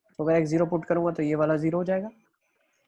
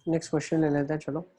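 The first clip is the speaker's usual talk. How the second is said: ordinary talk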